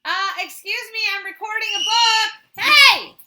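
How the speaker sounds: loud, high shout over the noise; annoyed shout. Real, not acted. Reader yelling at a noisy family member, annoyed shout. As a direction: yell to stop the interruption. Yelling at someone interrupting recording.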